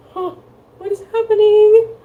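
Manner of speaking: high, loud squeal of alarm; shocked confusion. Acted. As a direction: act out the sudden panic.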